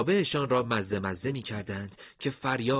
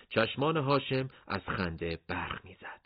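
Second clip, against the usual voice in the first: plain reading voice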